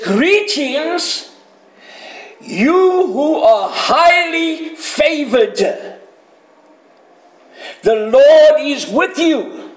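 high, slow, grand proclamation; ringing, drawn-out herald's greeting; angel's greeting. Acted. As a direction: proclaim it slowly like an announcing angel. Preacher voices the angel's greeting.

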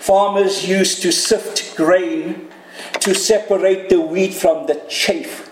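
ordinary talk, nothing marked

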